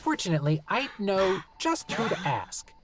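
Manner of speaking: high, cheerfully menacing gnome. Acted. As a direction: sweetly threaten to bury him.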